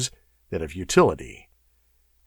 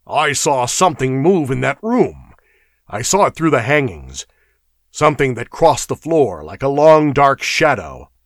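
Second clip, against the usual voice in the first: raised, emphatic warning; urgent cry; 5 semitones higher, 5 dB louder than usual. A narrator's reading. Cimmerian glaring at the doorway, crying out. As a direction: warn them urgently. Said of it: "The Cimmerian cried out suddenly".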